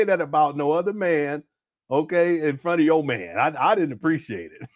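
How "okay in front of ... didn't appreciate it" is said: normal talking voice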